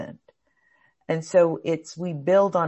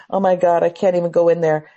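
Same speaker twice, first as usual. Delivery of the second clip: loud, rushed acted panic; mock panic; 8 dB louder than usual. Teacher acting out a spiraling anxious thought, mock panic. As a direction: blurt it like worries boiling over.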